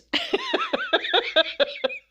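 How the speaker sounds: squeaky high burst of laughter; a high giggle at herself. Real, not acted. Laughing at her bad videos.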